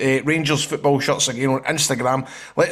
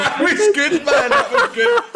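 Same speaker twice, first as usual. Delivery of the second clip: high squeaky laughter; laughing; 4 dB louder than usual. Real, not acted. Host still laughing at the joke, high.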